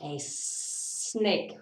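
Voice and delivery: long drawn-out S on snake; hissed snake. Pretend. Reader introducing a snake, hissing the S. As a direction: stretch the first sound of snake into a hiss.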